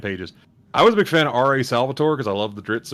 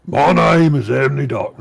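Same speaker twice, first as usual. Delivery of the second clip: higher, louder put-on orc; 4 semitones higher, 5 dB louder than usual. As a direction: read it as a loud orc. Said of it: "I'll do it in the orc voice".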